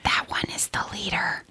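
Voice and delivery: hushed sneaking whisper. Acted. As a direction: whisper so the guards miss it. Spying on werewolves.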